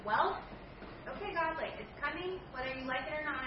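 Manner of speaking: loud, high defiant burst at God; defiant warning to God. Retold or imitated. She warns God her anger is coming, defiant and loud. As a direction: warn God it's coming whether he likes it or not.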